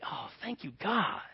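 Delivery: hushed thanks. Acted. Hushed grateful prayer.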